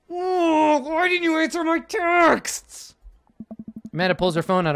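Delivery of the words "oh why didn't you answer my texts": high, slow, crying whine; whiny outburst. Acted. A dad whines at his daughter for ignoring his texts, high and slow. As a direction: wail it like a wounded parent.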